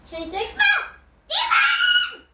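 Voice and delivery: very high, loud, laughing scream; mock yell. Real, not acted. Mock screaming rookie at his teammate. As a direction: shriek the insult, cracking up.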